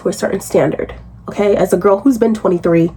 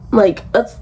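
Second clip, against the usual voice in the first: ordinary delivery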